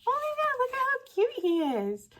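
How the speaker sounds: high-pitched gushing; cute squeal. Real, not acted. Swapper opening a cute vampire card, squealing. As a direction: squeal like it's the cutest thing ever.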